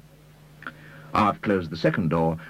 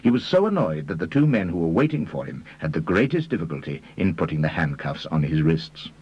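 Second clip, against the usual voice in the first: plain reading voice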